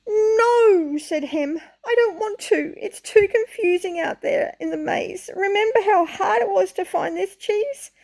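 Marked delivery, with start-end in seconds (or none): 0.1-1.0 stubborn refusal. Acted. Hem refuses in story. 1.8-7.9 stubborn whine. Acted. Hem refuses in story.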